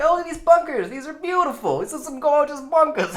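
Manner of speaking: high-pitched, hammed-up New York voice; comic high New Yorker, admiring; New York accent. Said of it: "I don't know why they're from New York now".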